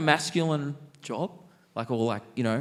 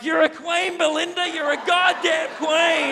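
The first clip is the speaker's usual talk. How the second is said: put-on drunk girly shout; 13 semitones higher than usual. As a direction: shriek it like a tipsy best friend hyping her up. Drunk girlfriends consoling their friend.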